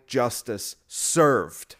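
"Justice served" drawn out very slowly; mock solemn verdict. Mocking lefty threatens critics, "Justice served" dragged out very slowly. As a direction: stretch each word of "Justice served" like a grand pronouncement. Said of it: "the compassionate people who would say".

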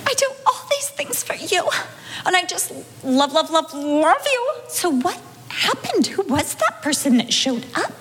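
high, half-crying plea to God, mock-sweet; pleading with the Lord, voice up high and teary; tearful prayer. Wife praying after the quarrel, half crying. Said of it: "saying to the Lord".

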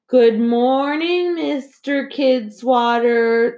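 slow sing-song chorus, pitched high; high, slow, dutiful schoolkids chanting; children in unison. A narrator's reading. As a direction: drag it out like a class greeting the principal together. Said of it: "the children said in unison".

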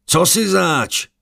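high, slow yell that breaks; frightened cry across the temple; voice cracking with fear. A narrator's reading. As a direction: shout bravely but let the voice crack. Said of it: "strachy přeskočil hlas".

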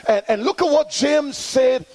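high, ringing call-and-response preaching; full-force preaching. Acted. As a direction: drive the congregation to answer back. Preaching to congregation.

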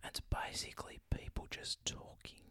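soft breathy whisper. Shown as a demonstration. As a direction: whisper it slowly. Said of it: "whispering and accents".

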